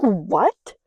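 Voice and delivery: loud stunned what; shocked. Retold or imitated. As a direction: blurt it out in shock. Shocked reaction to crack.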